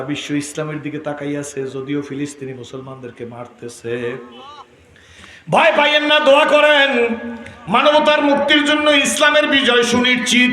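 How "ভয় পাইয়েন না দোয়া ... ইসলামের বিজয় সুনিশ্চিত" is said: high, loud rallying cry; full-force preaching. Real, not acted. Preacher promising the crowd victory, loud high proclamation.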